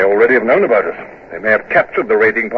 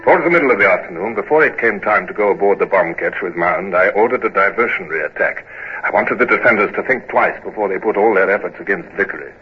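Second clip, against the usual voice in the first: story narration; 4 semitones lower, 3 dB quieter than usual. A narrator's reading. Narration of the day.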